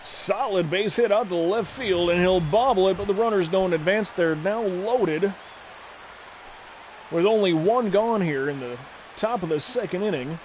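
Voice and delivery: radio announcer call, measured pace; baseball play-by-play. Acted.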